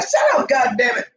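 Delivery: high-pitched retold scolding; mock-yell over the howling; exasperated mock yell. Sleepless owner to three howling dogs, high mock yell.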